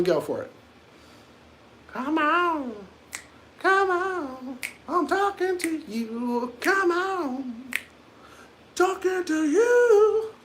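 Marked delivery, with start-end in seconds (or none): 1.9-10.3 much higher, pleading come on; coaxing high voice. Pretend. Streamer begging silent viewers, high squeaky coaxing.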